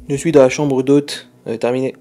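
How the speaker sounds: loud, into the walkie-talkie; loud radio call. Real, not acted. Calling over the walkie-talkie.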